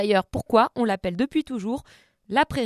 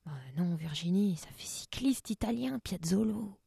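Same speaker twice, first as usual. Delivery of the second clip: much softer off-mic aside, lower; quiet aside; 3 semitones lower, 11 dB quieter than usual. Real, not acted. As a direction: mutter it to Virginie off the story. Aside scolding Virginie, off-mic.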